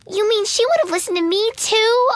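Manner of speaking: put-on high, eager childish voice; surprised girl. A narrator's reading. Olive realizing Ella must obey her too, high and eager. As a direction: squeak it out, amazed.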